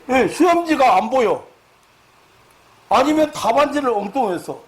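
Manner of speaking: loud, high-pitched preaching delivery; forceful preaching. Real, not acted. Preacher describing an exam-phobia sufferer to the congregation, forceful.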